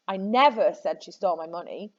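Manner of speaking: "never" made the strongest word; stress on NEVER. Shown as a demonstration. Teacher shows students how meaning shifts, stressing "never". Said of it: "where on earth you put the emphasis".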